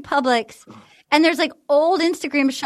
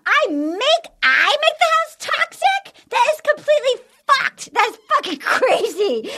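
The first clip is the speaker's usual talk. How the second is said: higher, hammed-up outraged rant; mock Victoria rant; 9 semitones higher than usual. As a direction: play the offended contestant storming off, pitched up. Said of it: "And she goes".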